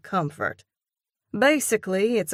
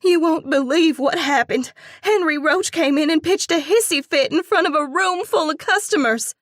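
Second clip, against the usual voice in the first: high, fast, breathless outrage; fluttering outrage; 11 semitones higher, 7 dB louder than usual. A narrator's reading. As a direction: spill the news in an indignant rush. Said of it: "Her voice flutters with outrage".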